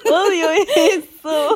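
slow, loud, squeaky laughter on each word; laugh-crying. Real, not acted. To her co-host, laughing hard, high and slow. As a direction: drag it out through tears of laughter.

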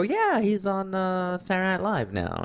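a clerk asks about a friend, answered high and chipper. Retold or imitated. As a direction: lift it up high and bright. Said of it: "And I go".

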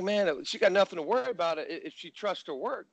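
pumped-up coach telling her to trust her work; emphatic advice. Retold or imitated. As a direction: push it up high with conviction. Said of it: "I'm like".

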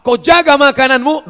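high, loud preacher's call; preaching shout. Acted. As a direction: cry it out from the pulpit. Preaching exhortation.

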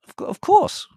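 very high, loud stammer; flustered indignation. A narrator's reading. Countess answering sacked servant, flustered and shrill. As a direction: stumble then squeal the answer.

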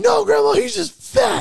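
high, loud mock protest; insistent yell. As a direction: shout back at grandma, imitating an outraged friend. Friend argues back to grandma, acted.

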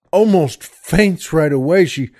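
ordinary talk, nothing marked

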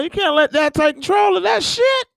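high, loud rant; rising shouted outburst. Real, not acted. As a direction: yell it out. Ranting shout.

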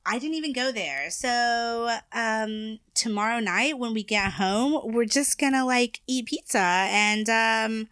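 put-on high Valley Girl uptalk; Valley Girl accent. Showing co-host Valley talk, Valley Girl accent. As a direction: make everything a question, Valley Girl style. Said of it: "The Valley Girls".

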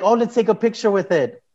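giddy newlywed proposing a photo; overexcited. Retold or imitated. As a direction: pitch it up like you can't wait for the photo. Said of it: "I was very overexcited".

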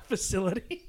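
punchline cracked through laughter; laughing. Real, not acted. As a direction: blurt the word out mid-laugh.

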